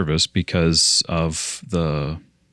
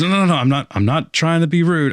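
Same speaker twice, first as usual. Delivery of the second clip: hurried protest; 10 semitones higher, 4 dB louder than usual. Retold or imitated. Freddie protests on the phone.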